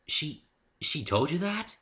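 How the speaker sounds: high, faltering little voice; embarrassed. Acted. Fluttershy asks Dave, high and flustered. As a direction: stumble over it, flustered.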